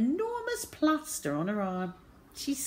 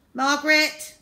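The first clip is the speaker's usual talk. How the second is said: high, loud nurse's summons; calling out; 10 semitones higher, 9 dB louder than usual. A narrator's reading. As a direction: summon the next child. Nurse calls next patient.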